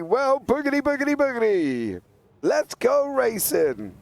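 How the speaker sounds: high, drawn-out catchphrase cry; mock race-start call. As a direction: playfully sing out the starting call, stretching the end.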